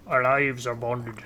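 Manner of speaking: put-on slow, heavy Russian accent. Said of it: "This is my Russian accent".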